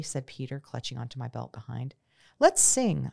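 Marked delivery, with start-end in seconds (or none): 2.4-3.1 suggested. A narrator's reading. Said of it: "I suggested".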